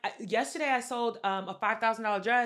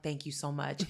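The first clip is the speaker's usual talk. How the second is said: soft mock politeness; 7 semitones lower, 8 dB quieter than usual.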